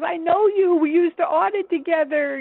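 imitating a delighted caller, very high-pitched; high, eager retold exclamation; excited recognition. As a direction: act out thrilled recognition of an old colleague.

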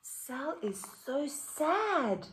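animated picture book read-aloud; reading to children. A narrator's reading. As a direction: read it out lively for the class.

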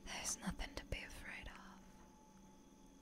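very soft, slow, soothing whisper; reassuring whisper. Acted. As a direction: hush their fear, barely voiced and slow. Hushed reassurance while holding eye contact.

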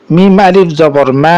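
level, sustained "মা" at the end; elongated final vowel. Shown as a demonstration. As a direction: spell it out, then hold the final "মা" long. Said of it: "মাকে টানলাম".

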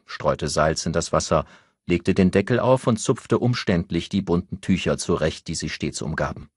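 ordinary delivery

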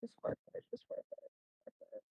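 hushed, breathy urging to the game; tense whisper. Real, not acted. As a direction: whisper it under your breath, willing it to work. Urging quietly during a fight.

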